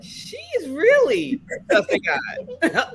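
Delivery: very high, drawn-out disbelief; mock amazement. Onlooker marvelling at her praying, high mock-amazed voice. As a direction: imitate marvelling, stretched out in a high voice.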